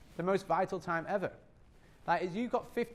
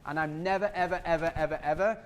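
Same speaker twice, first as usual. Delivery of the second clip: normal talking voice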